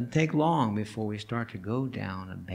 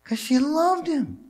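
high, slow, louder declaration; fervent insistence; 5 dB louder than usual. Real, not acted. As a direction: proclaim her love. Emphatic sermon point.

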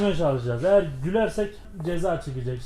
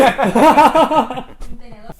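squeaky helium giggle; laughing on helium; 4 semitones higher, 9 dB louder than usual. Real, not acted.

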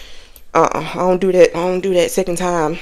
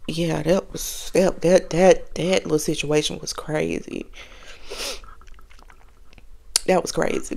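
quiet; 4 semitones lower, 11 dB quieter than usual. Real, not acted. Trailing reflective aside.

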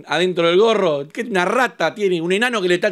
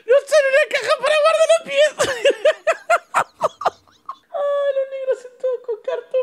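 high-pitched laughing through the words; laughing hard. Real, not acted. Reacting to the cardboard slippers, laughing hard. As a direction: crack up while getting the words out.

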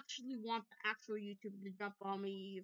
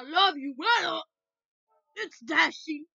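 loud, high shouted Dashy greeting; Dashy impression; 5 semitones higher, 15 dB louder, slower than usual. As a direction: belt it out as Dashy. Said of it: "I can't do a really good Dashy impression".

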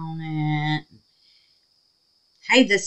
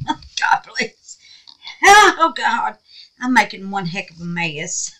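normal talking voice

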